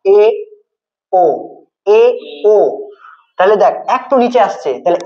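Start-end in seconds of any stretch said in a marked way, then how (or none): 0.1-2.6 এ and ও sounded singly, tongue slightly lowered; separated vowel demo. Shown as a demonstration. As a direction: sound out এ, pause, then ও. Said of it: "জিহ্বাটা একটু হালকা নিচে আসবে". (আলাদা করে এ, ও)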